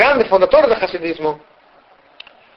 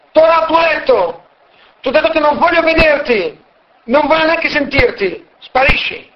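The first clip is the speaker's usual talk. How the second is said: yelling; 8 semitones higher than usual. Acted. Woodcutter yelling at the guest to go back to bed, angry yell. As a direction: drive the guest back to his room. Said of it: "subito urla e gli dice".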